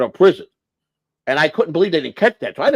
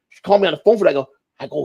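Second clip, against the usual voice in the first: ordinary talk, nothing marked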